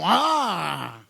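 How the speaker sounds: mock toothless roar; long wordless yell from a toothless mouth. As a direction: parody a roar with no teeth. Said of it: "Tapos sisigaw".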